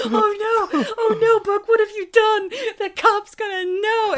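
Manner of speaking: high, loud laughing panic; mock dismay. Viewer pleading with the film's hero after his punch, mock dismay. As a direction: wail it in hammed-up alarm.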